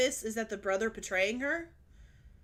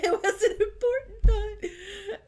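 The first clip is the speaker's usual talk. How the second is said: high, slow, loud wail through laughter; laughing protest; 11 semitones higher, 6 dB louder, slower than usual. Real, not acted. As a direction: protest while cracking up laughing. Laughing protest about forgotten thought.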